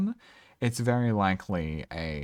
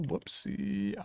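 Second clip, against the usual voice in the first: playful whoopsie; 13 semitones higher, 4 dB quieter than usual. Real, not acted. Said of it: "I just realized".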